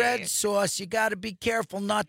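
Italian New Yorker giving advice; thick New York Italian voice; New York accent. Pretend. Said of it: "in my best New York accent".

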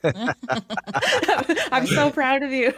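high, loud, through real laughter; laughing praise. Real, not acted. Guest teases the host over the long title, laughing. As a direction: laugh hard while cheering them on.

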